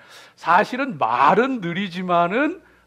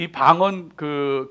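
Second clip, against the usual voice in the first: ordinary talk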